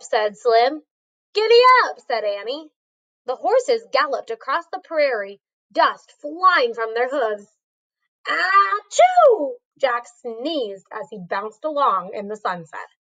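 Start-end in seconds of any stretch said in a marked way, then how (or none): 1.3-2.0 high, happy giddy-up call; excited girl call. A narrator's reading. Annie echoing Slim. 8.3-9.6 sharp acted sneeze. A narrator's reading. Jack sneezes.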